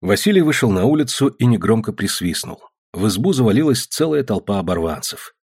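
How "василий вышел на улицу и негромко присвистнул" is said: ordinary delivery, nothing marked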